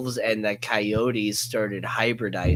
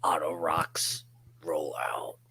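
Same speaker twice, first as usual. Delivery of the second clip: gravelly whisper; 7 semitones lower, 5 dB quieter than usual. Acted. Mock robot rock announcement.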